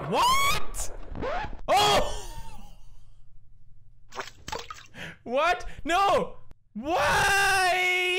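very high-pitched outcries of dismay; anguished disbelief. Real, not acted. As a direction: cry out as if robbed of a win. Fails the quiz, protests.

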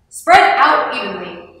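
loud, firm order; commanding. A narrator's reading. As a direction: give a loud command to the crowd. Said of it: "Otto commanded".

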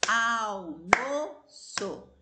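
Almoço slowly and loudly, syllable by syllable; syllables spelled out. Shown as a demonstration. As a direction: split Almoço into its syllables for the class. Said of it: "Essa palavra também tem três sílabas". (Almoço devagar, sílaba por sílaba)

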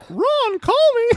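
high, loud squeaky voice. Retold or imitated. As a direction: shout it like a cartoon mouse. Squeaky voice continued.

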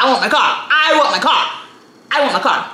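mock tantrum, keep insisting; childish pleading for her stolen car; insistent whine. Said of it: "And I'm like".

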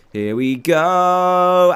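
very slow, stretched-out launch; drawn-out here we go. Real, not acted. Gamer leaping onto the ship, slow stretched cheer. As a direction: hold every word as you jump. Jumping again, drawn-out call.

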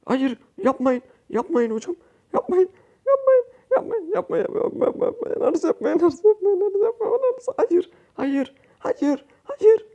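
very high, desperate begging cries; panicked pleading. Real, not acted. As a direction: beg them to stop, voice cracking up high. Losing the match, begging teammates. (takım arkadaşlarına yalvaran tiz panik çığlıkları)

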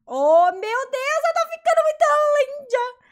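delighted squeal. Real, not acted. Admiring her mermaid avatar.

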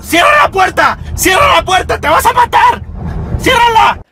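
high, loud panicked shout; frantic yelling. Acted. Mother frantically yelling to close the door. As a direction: scream the order in alarm.

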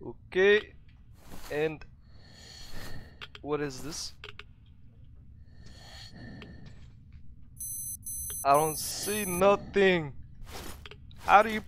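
put-on Russian-accented English. Gamer narrating his play in a put-on Russian accent. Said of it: "hard for me to do it Russian accent".

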